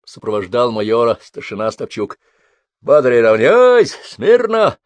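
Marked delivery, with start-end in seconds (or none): 2.8-4.8 bellowed parade commands, slow and loud; roaring drill sergeant. A narrator's reading. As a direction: roar the commands across the square. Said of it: "проревел Обух".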